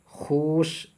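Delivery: खुश with a long oo, khoosh; spelled-out reading. Shown as a demonstration. Teacher reads what is written, खुश as long khoosh. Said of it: "लिखा हुआ है".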